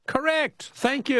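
high, elated quiz host cheer; celebrating. Real, not acted. Host greets the right answer at last, celebrating. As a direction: announce the winner with a high whoop of relief.

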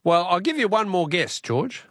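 ordinary talk

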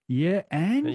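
mock indifferent shrug, rising on "and"; lightly acted "so what?"; collective unimpressed response. Guest voicing Australians' response during the Depression, mock unimpressed. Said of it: "was something of a collective".

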